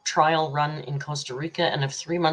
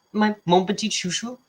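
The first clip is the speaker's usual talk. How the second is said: playful French; 5 semitones higher than usual. Real, not acted. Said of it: "I only know the bad words".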